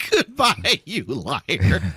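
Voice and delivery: mock farewell. Co-host gleefully echoing the letter writer's kiss-off, mock farewell. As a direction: draw out the goodbye in a mocking lilt. Mockingly echoes the letter's parting line.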